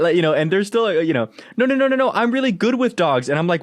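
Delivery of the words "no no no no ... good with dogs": high-pitched, run-on mock protest; insistent stranger. Dog owner mimics stranger insisting to pet, high-pitched protest. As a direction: imitate a stranger brushing off warnings.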